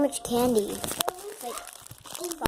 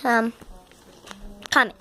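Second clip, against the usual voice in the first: ordinary talk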